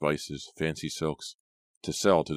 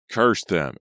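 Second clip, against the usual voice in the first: louder, higher furious curse; scream; 4 semitones higher, 7 dB louder than usual. Acted. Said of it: "Ezra screamed".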